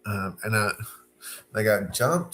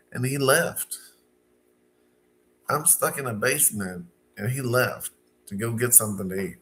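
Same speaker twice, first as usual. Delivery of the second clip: usual speaking voice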